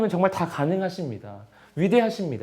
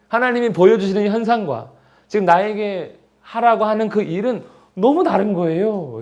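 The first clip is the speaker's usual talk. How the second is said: ordinary talk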